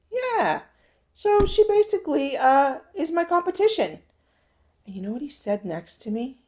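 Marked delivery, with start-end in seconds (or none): 0.1-4.0 much higher and a bit louder, exasperated; worked-up exclaiming. Retold or imitated. Telling her husband about the rival, highly worked up. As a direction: spill it out like you can't believe it.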